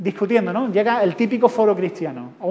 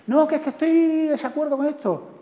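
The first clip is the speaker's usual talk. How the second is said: put-on protesting child; 7 semitones higher than usual.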